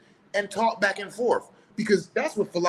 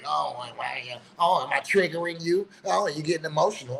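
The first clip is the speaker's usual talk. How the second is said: mocking mimicry. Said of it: "texting and then projecting".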